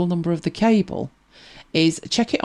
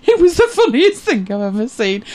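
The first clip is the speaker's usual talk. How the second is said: high, loud, laughing through the words; helpless with amusement, voice pitched up; real laughter; 6 semitones higher, 5 dB louder than usual. Real, not acted. Laughing at own rabbi anecdote.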